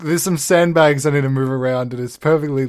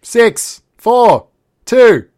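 mocking countdown; 10 semitones higher, 10 dB louder, much slower than usual.

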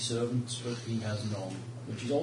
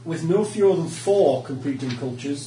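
reading lore aloud; 4 dB louder than usual. Acted. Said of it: "Here we go".

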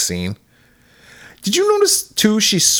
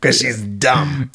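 mocking dumb voice; much slower than usual. Explaining the joke mockingly.

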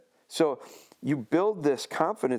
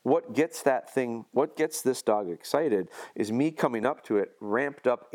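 normal talking voice

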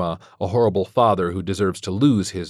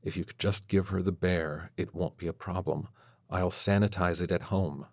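murmuring; 7 dB quieter than usual. A narrator's reading. Father murmuring into the teacher's hair, soft. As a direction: keep it under your breath, private. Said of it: "murmuring into her hair".

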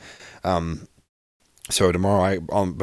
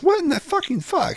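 very high, squeaky mock outrage; exasperated disbelief. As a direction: voice shoots way up in baffled frustration. Retold outburst at both dripping.

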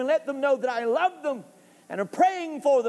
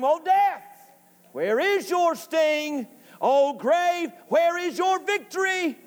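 ringing, high-pitched preacher's challenge; taunting Death; 5 semitones higher, slower than usual. Acted. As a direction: throw the question defiantly at death and grave. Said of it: "He's taunting".

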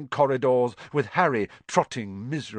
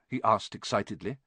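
usual speaking voice

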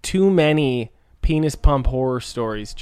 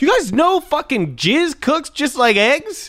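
excited high voice; 11 semitones higher than usual. Pretend. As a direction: pitch up, gushing like a discovery. Mock revelation to the audience.

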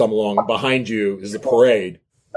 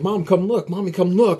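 put-on child shouting; 8 semitones higher than usual. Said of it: "starts shouting".

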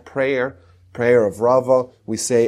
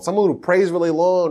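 ordinary talk

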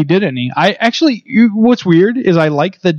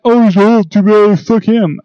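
mock ageist; 5 semitones higher, slower than usual. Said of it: "I'm not like".

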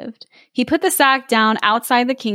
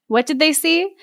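ordinary delivery, nothing marked

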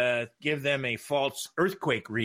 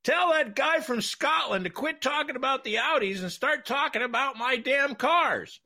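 much higher, gruff angry team boss on the phone; angry Panos; 14 semitones higher than usual. Retold or imitated. Team owner phoning the TV truck to complain, angry high mock voice. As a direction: bark it like a furious owner. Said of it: "and it's Panos".

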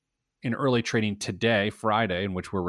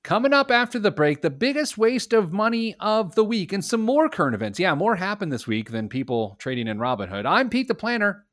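upbeat announcer voice, pitched up; radio host intro; 10 semitones higher, 3 dB louder than usual. Acted. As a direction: hype the next segment. Show intro tease.

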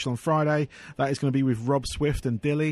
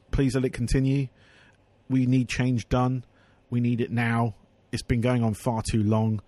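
usual speaking voice

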